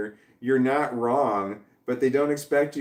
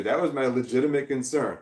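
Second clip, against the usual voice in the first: usual speaking voice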